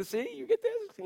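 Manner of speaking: playful high coaxing; coaxing parent. Retold or imitated. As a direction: tempt a toddler with a prize. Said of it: "I'd be like".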